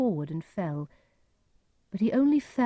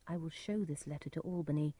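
very soft private aside; talking to himself; 10 dB quieter than usual. A narrator's reading. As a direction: murmur it as a thought aloud. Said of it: "Edgar said to himself".

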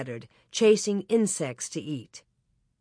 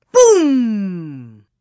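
booming explosion; 10 dB louder than usual. A narrator's reading. Cannon-like blast jolting sleepers awake, booming. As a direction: sound the blast, long and falling.

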